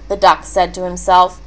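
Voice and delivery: plain reading voice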